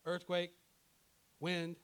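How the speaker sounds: ordinary delivery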